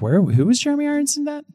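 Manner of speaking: high-pitched puzzled question; retold confusion. Recalling own mix-up about the film, puzzled. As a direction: pitch way up, acting out bafflement. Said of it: "I was like".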